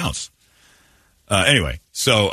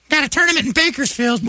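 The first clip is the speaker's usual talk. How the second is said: high put-on falsetto; mock softball player. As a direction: pipe up in an innocent squeaky voice.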